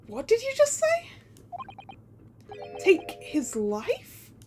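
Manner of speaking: slow down on the repeated words in disbelief; stunned disbelief. Acted. Accused man hearing of a death, stunned.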